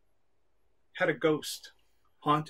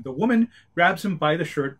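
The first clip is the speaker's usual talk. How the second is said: usual speaking voice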